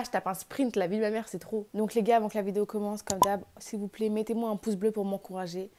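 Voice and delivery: usual speaking voice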